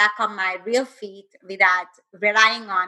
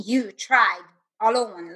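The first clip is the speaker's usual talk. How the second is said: normal talking voice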